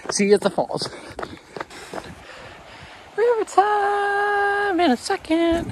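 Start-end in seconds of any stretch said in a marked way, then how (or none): 3.2-5.7 silly high voice. Acted. Joking voice.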